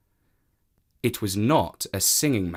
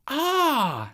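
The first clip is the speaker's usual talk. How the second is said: high admiring sigh; admiring woman. Acted. Woman admiring the cat, high. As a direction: marvel at the cat.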